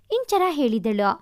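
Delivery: plain reading voice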